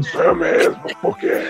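horror trailer voice. Retold or imitated. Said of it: "com a voz de filme de terror do caralho".

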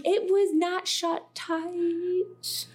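high, drawn-out protest; retold objection, pitched right up and stretched out; insistent protest. Teller replaying their protest, high and insistent.

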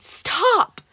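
a high cry of Stop; boy shrieks to halt the kick. Acted. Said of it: "The young boy cried".

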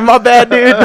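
high, loud laughing sorry; laughing apology. Retold or imitated. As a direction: apologize while cracking up. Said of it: "And I was like".

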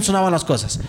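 ordinary delivery

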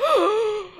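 sharp shocked gasp. Acted. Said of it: "and you're like".

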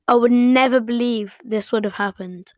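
normal talking voice

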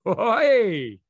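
high, loud mock shout; playful shout. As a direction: bark a playful oi at himself. Caught himself, shout.

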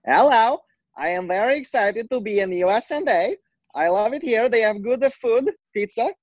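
high-pitched mock Kazakh accent; Borat. Giving friends a sample, comic Kazakh accent. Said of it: "I talk with a Borat accent".

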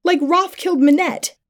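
high-pitched accusing retort; shouting back. A narrator's reading. Attacker accusing the stabbed man, high defensive shout. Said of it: "I shouted back".

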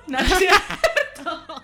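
laughing through the words; giggling while speaking. Real, not acted. Laughing after the catchphrase.

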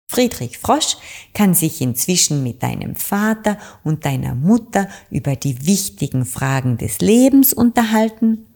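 ordinary delivery, nothing marked

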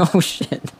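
laughing after spitting a drink; spit take laughing. Real, not acted. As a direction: sputter through laughter. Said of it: "that was a genuine spit take".

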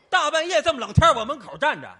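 indignant outburst. Acted. Host complaining at the visitor at his door: loud, fast laughing protest. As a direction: blurt out the outrage with a laugh.

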